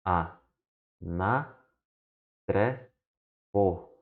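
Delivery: slow separate syllables. Shown as a demonstration. Teacher showing syllable division, slow separate syllables. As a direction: break it into syllables for the learners. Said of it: "Temos também".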